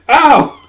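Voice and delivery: sharp high loud ow; pained yelp. Acted. Gija bumps his head and yelps loudly.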